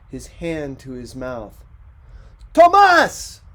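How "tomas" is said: very loud, high yell of a name. A narrator's reading. Ricardo yelling at his son in the street, full yell. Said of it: "yelled Ricardo".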